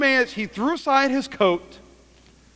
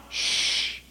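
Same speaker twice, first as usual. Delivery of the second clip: long unvoiced shush; 6 dB quieter than usual. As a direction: hush someone with a drawn-out shh. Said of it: "this is what everybody says around him".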